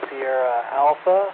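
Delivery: ordinary talk, nothing marked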